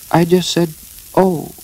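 high, mildly nervous small voice. A narrator's reading. Piglet covers up to Eeyore, a touch nervous. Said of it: "said Piglet nervously".

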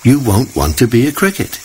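a hint of a stern mother; scolding. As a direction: tell him off, disbelieving. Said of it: "his mother scolded".

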